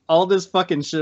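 ordinary talk, nothing marked

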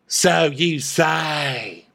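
very slow, drawn-out disdain; Diana. Acted. Diana doubting Sutton, slow theatrical disdain. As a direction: stretch every word dismissively. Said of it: "I'll be Diana and you be Sutton".

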